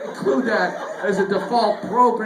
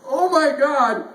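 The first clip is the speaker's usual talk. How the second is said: higher, louder, slow gasp of disbelief; shocked oh my God; 6 semitones higher, 4 dB louder, slower than usual. Retold or imitated. Comedian reacting to a coworker's remark, slow and shocked. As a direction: react like you just heard something awful. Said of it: "I was just like".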